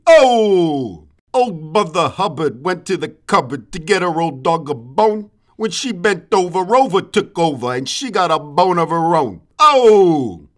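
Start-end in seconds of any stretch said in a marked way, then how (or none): 0.1-1.1 loud comic "Oh!" after the joke; punchline shout. Acted. Roaster caps a nursery-rhyme joke with a loud Oh. 9.6-10.5 loud comic "Oh!" after the joke; punchline shout. Acted. Roaster caps a nursery-rhyme joke with a loud Oh.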